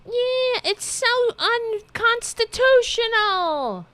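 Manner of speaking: high, screechy caricature of yelling; mock yelling. Host imitates what the politician is yelling, mock screech. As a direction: shriek it slowly, mocking her outrage. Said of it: "Is that what you're yelling?".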